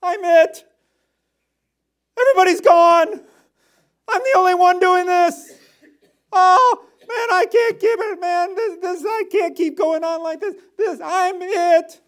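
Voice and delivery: very high, loud, half-laughing wail; hammed-up sobbing self-pity; whining Elijah. Preacher mocking Elijah's despair, very high and wailing. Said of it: "What's he think?".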